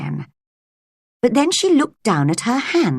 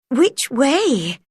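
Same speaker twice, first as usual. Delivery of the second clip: higher, worried second asking; a little afraid; 6 semitones higher than usual. A narrator's reading. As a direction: ask again more anxiously. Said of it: "she asked herself, a little afraid".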